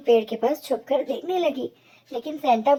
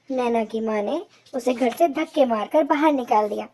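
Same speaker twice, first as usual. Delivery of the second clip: usual speaking voice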